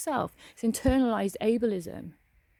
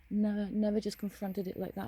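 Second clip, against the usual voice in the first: ordinary talk, nothing marked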